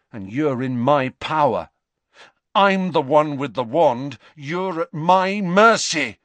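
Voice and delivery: put-on a shaky boy trying to sound in control; defiant boast. Malfoy to Dumbledore, boasting he holds the wand.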